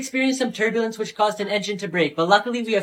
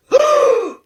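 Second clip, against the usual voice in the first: very high, loud gasp; shocked gasp; 8 dB louder than usual. Acted. Kid's shocked gasp at the mailman news.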